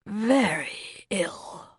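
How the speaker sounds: breathless, queasy whisper, slowed right down; sickly whisper. Acted. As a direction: barely get the words out, as if about to be sick. Sudden sickness, about to retch.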